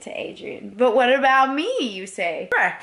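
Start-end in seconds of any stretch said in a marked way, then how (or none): none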